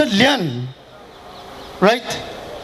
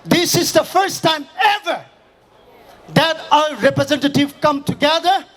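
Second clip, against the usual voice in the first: rally speech; 4 semitones higher than usual. Acted. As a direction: rouse the crowd, voice raised. Fiery speech to crowd at rally.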